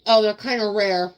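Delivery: talking while chewing; words round a bite of food; mouth full. Real, not acted. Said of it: "Talking with my mouth full".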